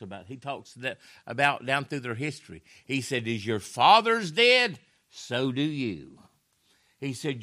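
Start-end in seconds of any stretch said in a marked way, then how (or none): 3.3-6.2 Stephen scolding the crowd, slow and stern; stern rebuke. Acted. Preacher voicing Stephen rebuking crowd.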